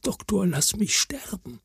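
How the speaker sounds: breathless whisper, barely voiced; a frail plea whispered close to the ear. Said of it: "flüstert mir ins Ohr".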